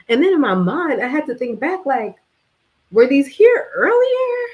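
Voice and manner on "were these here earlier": much higher, louder and slow, spooked; finding ants on the bathroom floor, alarmed. Real, not acted. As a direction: ask it in sudden alarm. Startled by ants.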